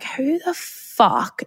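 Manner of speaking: high, slow, stretched-out disbelief; shocked disbelief. Retold or imitated. Reacting to the sister's text, stunned and drawn out. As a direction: drag it out, stunned by the coincidence.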